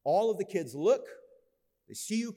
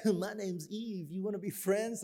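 mock shy kid; 5 semitones higher than usual. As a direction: play the awkward kid introducing herself. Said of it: "and be like".

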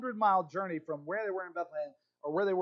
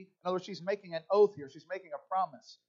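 ordinary talk, nothing marked

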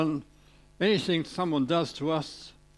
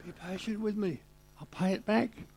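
begging servant; 6 semitones higher, 3 dB quieter than usual. Acted.

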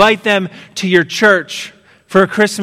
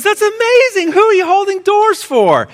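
much higher, thrilled mother's voice; delighted mom. Retold or imitated. Mother filming her son in the car: thrilled high voice. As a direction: gush like a proud mom. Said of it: "the mom says".

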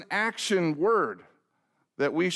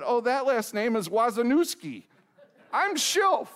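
rising, laughing exasperation at the counter; exasperated correction; 6 semitones higher than usual. Retold or imitated. Pastor correcting the cake girl, laughing exasperation. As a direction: correct her with a laughing, frustrated edge.

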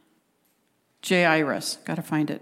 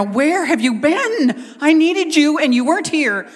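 hammed-up accusing outburst; wife's angry reproach; 8 semitones higher, 5 dB louder than usual. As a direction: blast him for not showing up. Wife blasting husband, acted again.

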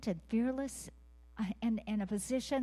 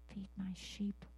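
softer, whispered charge; 7 dB quieter, slower than usual. Real, not acted. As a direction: breathe the words like a secret. Whispered commission in prayer.

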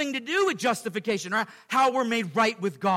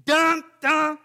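mock dramatic sting; 6 semitones higher, 6 dB louder than usual. Sermon sound-effect punchline.

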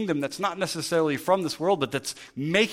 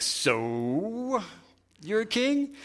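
rising, disbelieving question, pitched higher; incredulous Pilate; 6 semitones higher, much slower than usual. Retold or imitated. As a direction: ask it like you can't believe it. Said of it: "Pilate's inflection".

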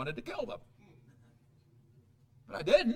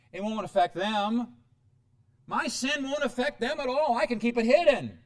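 higher, louder mock insistence; defiant self-justification; 5 semitones higher, 5 dB louder than usual. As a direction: overconfident denial, building to a loud finish. Self-deluding parent insisting sin stays hidden.

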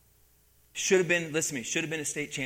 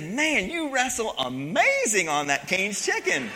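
slow, laughing dig at him; laughing mock praise; 6 semitones higher, 6 dB louder, slower than usual. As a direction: tease him through a laugh, slowly. Coach mocks wrestler after he lost.

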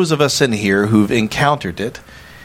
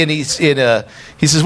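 ordinary talk, nothing marked

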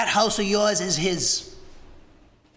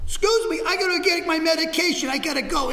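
mock nervous kid; 7 semitones higher than usual. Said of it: "wife, she said".

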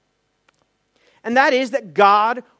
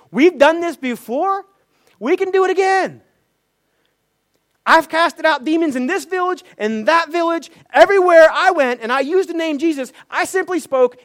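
raised, boastful cocky voice; overconfident disciples; 6 semitones higher, 5 dB louder than usual. Retold or imitated. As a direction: brag like you've done it all before. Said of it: "overly confident and thinking".

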